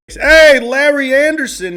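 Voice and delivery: loud, high excited call of the name; excited shout. Real, not acted. Vlogger hypes up a card he loves, shouting the name. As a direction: belt the name out like a hype man.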